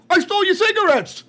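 high, loud mock Boston accent; mock Boston uncle. Playing the skit's uncle, loud mock Boston accent. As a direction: shout it like a hammed-up Boston uncle. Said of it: "And then the uncle's like".

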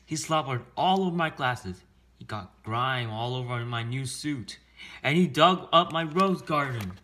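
loud, high-pitched griping; angry complaint. Acted. Mayor complaining about the dog's mess, angry outburst. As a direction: list the outrages, punching them loudly.

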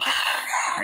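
rasping voiceless mock growl; breathy monster snarl; imitated werewolf roar. Reviewer acting out the jump scare, snarl.

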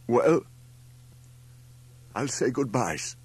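put-on high, foreign-accented, wavering farewell; voice breaking. A narrator's reading. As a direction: start the goodbye on the edge of tears. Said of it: "his voice wavered and broke".

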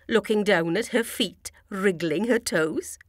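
usual speaking voice

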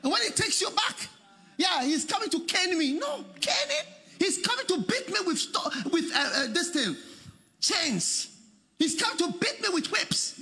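high, fretful mock complaint; hammed-up worry, half laughing; mock fearful son. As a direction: play the scared prodigal.

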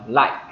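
"like" ending in an audible K burst; teacher's demo of "like" with the K sounded; released K. Shown as a demonstration. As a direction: release the final K of "like" clearly. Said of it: "phát cái âm k ra".

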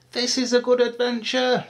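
high little voice, no laugh; delighted high-pitched youngster; happy baby elephant. A narrator's reading. Baby elephant paddling on her adventure, high and happy. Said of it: "she laughed".

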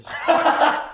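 slow loud laughing; laughing loudly. Real, not acted. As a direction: laugh while straining. Laughing while stretching.

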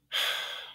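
unvoiced breathy sigh of annoyance; exasperated sigh. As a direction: let out a weary sigh. Sighs in exasperation after "Sigh.

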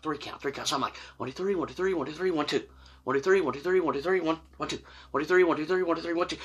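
very soft, repetitive counting under the breath; acting out anxious self-talk, barely voiced; nervous muttering. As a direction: mutter it to yourself like a worried chant. Counts nervously to himself in line.

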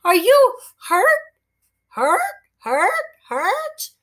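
high squawking parrot voice. Acted. Parrot squawking at the boy. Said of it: "the parrot squawked".

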